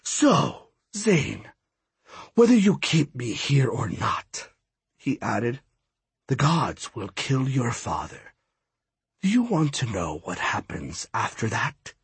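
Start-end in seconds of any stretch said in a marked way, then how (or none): 0.0-4.5 lightly voiced taunting god; menacing villain god. Villain god's dialogue. 6.3-11.9 quieter, threatening god voice; menacing villain god. Acted. Villain god's dialogue.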